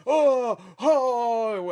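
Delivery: high, loud mock groans of waking up; mock groaning. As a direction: ham up a pained waking groan. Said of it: "cringy scene of him, like, going".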